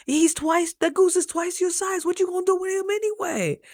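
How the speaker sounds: high, incredulous scolding of the dog; high exasperated scolding of the dog. Retold or imitated. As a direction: tell off the dog in disbelief. Scolding her dog Raj.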